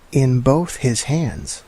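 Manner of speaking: ordinary delivery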